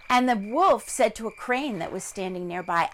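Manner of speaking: plain reading voice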